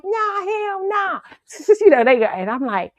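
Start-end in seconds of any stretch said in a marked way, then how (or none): 0.0-1.2 drawn-out refusal. Retold or imitated. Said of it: "I was like".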